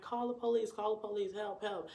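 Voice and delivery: high panicked pleading calls; frantic cry for help. Retold or imitated. Retelling how she begged onlookers for help, high panicked calls. Calling to lady on porch.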